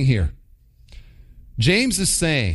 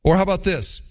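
normal talking voice

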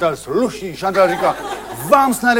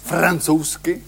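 announcer intro; 3 semitones lower, 9 dB quieter than usual. Acted.

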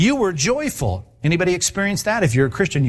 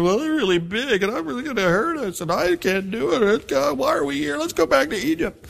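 much higher, whining voice that climbs as it goes; whiny complainer; 9 semitones higher than usual. Retold or imitated. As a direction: play a frightened grumbler begging to go back. Voicing the fearful complaining Israelites.

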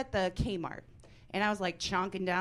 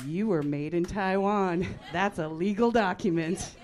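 mock official announcement; 5 dB louder than usual. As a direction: declare it like a solemn fact. Tricking sister as a prank.

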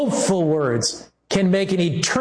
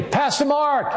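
high call up to a balcony; calling out from afar, high; mock yell from the balcony; retold yell; 9 semitones higher than usual. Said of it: "he yelled down at me".

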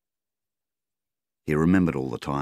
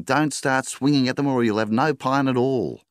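higher, louder warning; wife's voice; 10 semitones higher, 4 dB louder than usual. A narrator's reading. Wife warning her husband not to fight, higher pleading voice. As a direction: caution him firmly.

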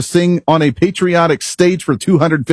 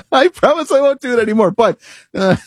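laughing through words; 9 semitones higher than usual. Real, not acted. Laughing at his own accent.

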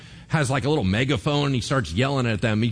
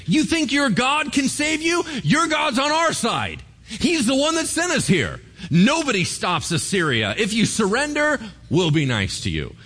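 bully taunt; 10 semitones higher than usual. Retold or imitated. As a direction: jeer at them from below. Assyrian bully taunting from below.

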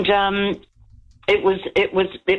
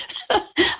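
breathy laugh. Real, not acted. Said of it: "I'm still laughing today".

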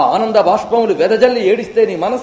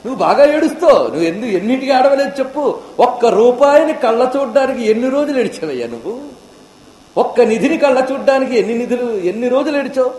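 scolding rebuke; 6 semitones higher than usual. Acted. Ramakrishna rebuking a seeker who cries for money but not God. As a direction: scold him for weeping over money. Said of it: "Ramakrishna Paramahamsa చెప్పేట".

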